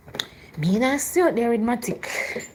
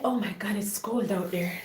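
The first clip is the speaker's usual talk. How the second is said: whisper; 3 semitones lower, 9 dB quieter than usual. Real, not acted. Reacting to the cold.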